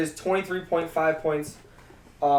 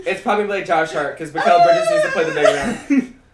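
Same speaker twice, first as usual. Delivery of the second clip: laughing; 9 semitones higher, 6 dB louder than usual. Real, not acted. Laughing about Mikal Bridges.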